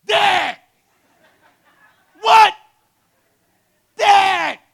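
very high, very loud desperate scream; screaming for dad. Retold or imitated. Naked son screams for his dad, desperate. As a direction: shriek for your father. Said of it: "I'm like".

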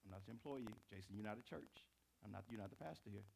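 firm husband. Retold or imitated. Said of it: "I'm like".

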